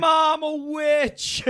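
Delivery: put-on high, slow, loud childish shriek; scared kid. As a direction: squeal it like a terrified little kid. Kids scream in retold scene.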